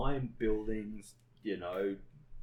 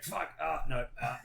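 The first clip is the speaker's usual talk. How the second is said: higher-pitched mock cries of pain; mock pained cursing; 5 semitones higher, 3 dB louder than usual. Retelling a twisted ankle, mock pained cursing. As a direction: curse as if acting out a sudden twinge.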